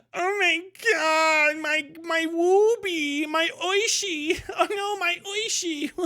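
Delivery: very high, drawn-out hammed-up wail; mock wailing. Streamer mocking fans distraught over their favorite, mock wailing. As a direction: whine and blubber in mock distress, dragging the words out.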